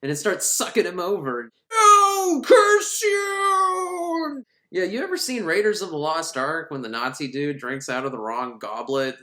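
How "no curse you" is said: very high, loud, anguished cry; mock villain scream. Villain dragged into the cauldron, hammed-up wail. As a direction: wail as you're dragged away.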